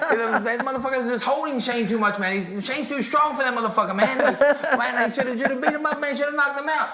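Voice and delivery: high-pitched ranting champ; squeaky, worked-up boxer impression; Mike Tyson. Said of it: "It's Mike Tyson. He goes".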